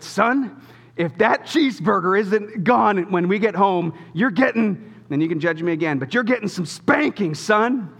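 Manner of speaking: mock stern father threat; stern dad warning. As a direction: lay down the law to the kid. Dad threatens son in car.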